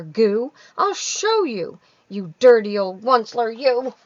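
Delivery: loud, high Lorax snapping in anger; the Lorax scolds the Onceler, dander up; angry snap. Acted. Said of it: "snapped the Lorax, his dander was up".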